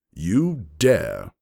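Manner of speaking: loud, outraged growl. A narrator's reading. Lord Hex growling at the noble who insulted him. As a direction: snarl it like a threatened lord. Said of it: "he growled".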